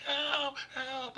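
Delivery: high, loud mock scream for help; hammed-up girl calling for help; mock cry for help. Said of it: "there's a girl's like".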